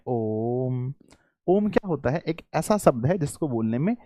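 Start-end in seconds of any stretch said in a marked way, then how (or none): 0.1-0.9 ओम with the vowel stretched long, like a held chant; prolonged Om. Shown as a demonstration. Teacher demonstrates the pluta vowel to pupils, drawn-out ओम. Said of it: "प्लुत स्वर में".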